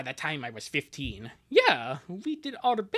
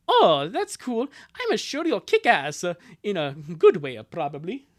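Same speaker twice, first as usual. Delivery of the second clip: loud encouragement that falters and drops; hint of a brother backpedalling; lightly acted awkward brother; 7 dB louder than usual. Voices comic dialogue.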